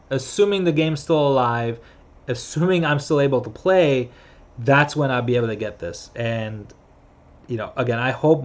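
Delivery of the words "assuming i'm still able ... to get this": normal talking voice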